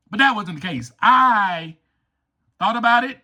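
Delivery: ordinary delivery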